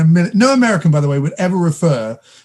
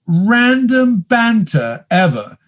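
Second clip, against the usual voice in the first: usual speaking voice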